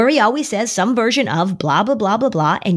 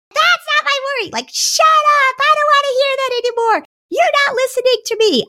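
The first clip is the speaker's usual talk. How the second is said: put-on very high, squealed child tantrum; mock-furious kid voice, pitched way up; angry kid; 4 dB louder than usual. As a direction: squeal it like a child refusing to listen. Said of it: "And then their kid says".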